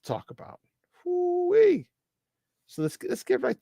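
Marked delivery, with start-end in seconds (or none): 1.0-1.9 squealed far higher; sudden high excited whoop; woo-wee. Real, not acted. Exclamation of excitement.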